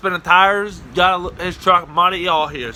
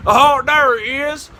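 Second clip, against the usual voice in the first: high, stretched cry of spotting someone; mock discovery; 8 semitones higher, 5 dB louder, slower than usual. Searching cops spotting the dummy, excited call.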